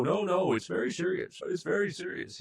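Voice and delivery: high-pitched, whiny protest in a mock Seth Rogen voice; pleading defensively, imitating a famous comedian's voice; Seth Rogen impression. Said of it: "my best attempt at the Seth Rogen laugh".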